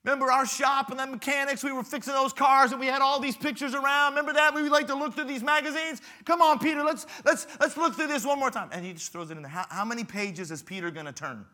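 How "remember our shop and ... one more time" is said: high, loud reminiscing friend; hammed-up buddy voice; nostalgic mechanic. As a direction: coax the dead friend.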